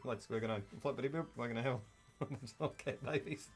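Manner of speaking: soft, rambling mock Australian; Australian accent. Hero propositioning the Na'vi woman, in a soft Australian accent. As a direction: sweet-talk as a crude Australian hero. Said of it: "talk with an Australian accent".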